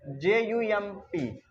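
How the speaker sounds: normal talking voice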